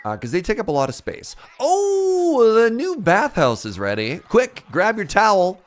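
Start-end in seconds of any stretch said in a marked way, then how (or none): 1.6-5.6 excited. Real, not acted. Excited announcement of bathhouse.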